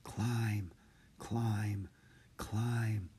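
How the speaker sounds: soft, unvoiced spider whisper. A narrator's reading. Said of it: "the spider whispered".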